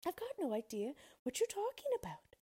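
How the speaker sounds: put-on high-pitched cross little girl. A narrator's reading. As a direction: protest crossly as a child. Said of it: "said Dorothy crossly".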